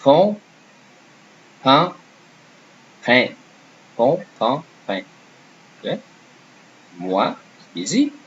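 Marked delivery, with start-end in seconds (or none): 0.0-5.0 "Pont", "pain", "pain" each with a distinct nasal vowel, rising; careful French pronunciation drill. Shown as a demonstration. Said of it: "it's important to, uh, say the sound correctly".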